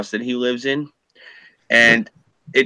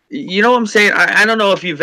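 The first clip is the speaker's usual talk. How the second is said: high, loud rising outburst; exasperated high burst; worked up; 11 semitones higher, 5 dB louder, faster than usual. Real, not acted. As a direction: fling the question up high. Worked up about rich liberals.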